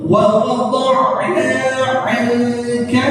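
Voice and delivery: "Wa wada'na 'anka" recited slowly, mad held two counts; slow tajwid recitation demo; model recitation. Shown as a demonstration. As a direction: chant it slowly like Quran recitation. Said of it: "Jadi cukup".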